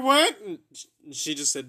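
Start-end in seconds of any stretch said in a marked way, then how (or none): 0.0-0.4 shocked shout. Retold or imitated. Shocked reply to mom.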